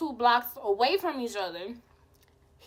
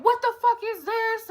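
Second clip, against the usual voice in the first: mock outraged boyfriend, pitched up; angry boyfriend; 10 semitones higher than usual. Boyfriend walks in on sister's kiss, mock outrage higher. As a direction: imitate his shocked outrage, a bit higher. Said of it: "he's like".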